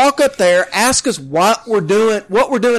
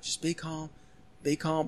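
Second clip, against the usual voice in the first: hushed soothing; 7 semitones lower, 19 dB quieter than usual. Retold or imitated. Whispering calm as cop pulls behind.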